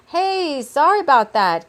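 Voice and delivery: high, loud, easygoing apology; friendly Kay voice. A narrator's reading. Kay apologises after the collision, high upbeat voice.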